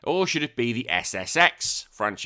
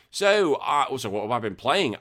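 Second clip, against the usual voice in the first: ordinary talk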